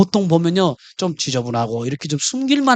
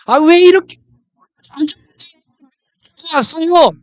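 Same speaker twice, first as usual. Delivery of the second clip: sharp, higher, louder scolding of a patient; 8 semitones higher, 4 dB louder than usual. Retold or imitated. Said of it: "야단을, 야단을 치면서". (높고 크게 야단치듯)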